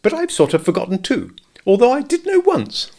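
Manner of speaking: high, bustling Rabbit voice; fussy rabbit, pitched well up. A narrator's reading. Rabbit puzzling over the pole, high and fussy.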